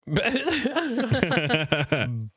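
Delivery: high, bright burst of real laughter; cracking up at the silly blimp name. Real, not acted. Friend laughing at a joke blimp name, laughing hard.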